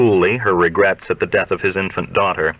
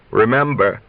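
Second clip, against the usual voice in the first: coyly intoned. Acted. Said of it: "The last word is intoned coyly".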